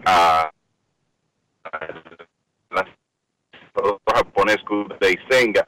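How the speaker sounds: choppy phone line cutting out; breaking up phone line. Real, not acted. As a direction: voice breaking up over a bad call. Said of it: "se oye cortado".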